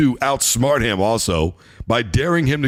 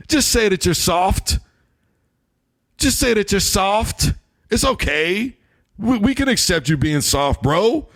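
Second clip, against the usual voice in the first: taunting; 8 semitones higher than usual. Real, not acted. Taunting players who won't fight back.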